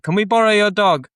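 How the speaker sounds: a playful imitation of a neighbour at the door; imitating the neighbours. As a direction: put on the neighbours asking sweetly for the dog. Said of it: "and be like".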